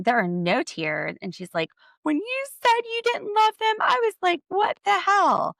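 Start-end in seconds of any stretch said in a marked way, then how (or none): 2.0-5.5 high, loud mock-tearful whine, slowing at the end; mocking Robin's fake cry. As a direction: squeak it out like a fake weepy girl's girl. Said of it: "she literally starts crying ... or fake crying".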